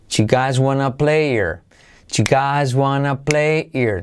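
"do you guys wanna play here" as choo guys wanna play-ear; linked colloquial choo-guys-wanna-play-ear; contracted, H skipped. Shown as a demonstration. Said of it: "Saltamos la H".

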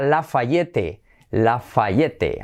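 LAFAYETTE slowly as "la-fa-YE-te", every vowel sounded; spell-read LAFAYETTE in Spanish. Shown as a demonstration. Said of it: "escrita Lafayette".

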